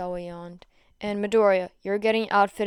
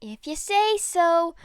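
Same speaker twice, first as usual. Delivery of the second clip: shrugging tease; 7 semitones higher, 4 dB louder, slower than usual. Acted. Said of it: "she shrugged".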